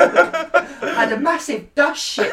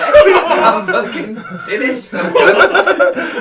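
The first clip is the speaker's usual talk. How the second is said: laughing it out, a bit louder; 5 dB louder, much slower than usual. Real, not acted. Co-host laughing in disbelief at the poo-sniffing video, cracking up. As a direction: give up through real laughter.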